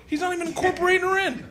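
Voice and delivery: high, rushed, incredulous complaint; indignant aside. Retold or imitated. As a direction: squeal it out fast in disbelief. Indignant aside about the guy.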